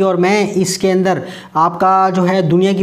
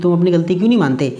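ordinary talk

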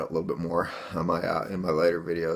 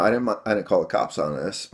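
normal talking voice

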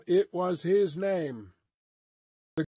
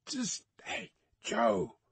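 warning voice; 5 semitones higher, slower than usual. A narrator's reading. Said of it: "called the coachman in a warning voice".

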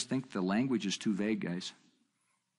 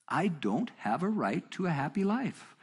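slow, raised-pitch insistence, not shouted; measured emphatic restatement; 7 semitones higher than usual. Retold or imitated. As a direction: restate it deliberately, word by word. Repeats his answer less explosively.

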